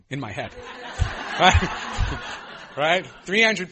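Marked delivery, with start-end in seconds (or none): 0.6-3.7 laughing. Real, not acted. Laughing at his own arrogance.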